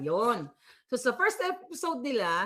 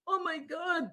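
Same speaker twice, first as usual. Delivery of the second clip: softer, higher mock whimper; tearless fake crying; 6 semitones higher, 5 dB quieter than usual. Host mimics woman crying without tears, mock crying. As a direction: imitate a put-on tearful lament. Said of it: "Walang luha".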